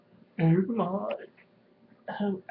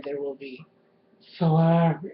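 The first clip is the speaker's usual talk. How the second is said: ordinary delivery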